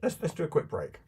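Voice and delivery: sounding far off down a well. Real, not acted. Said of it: "you do sound like you're at the bottom of a well".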